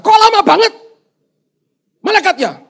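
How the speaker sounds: high, loud outburst of impatience; impatient yelling. Acted. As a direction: scold the latecomer at full voice. Stranded man scolds late angel.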